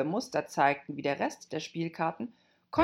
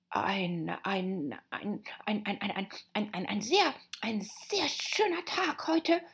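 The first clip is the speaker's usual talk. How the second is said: nervous stammer climbing high; timid; 4 semitones higher than usual. Acted. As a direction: stammer your way shyly into small talk. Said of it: "eine schüchterne Stimme an ihrer Seite".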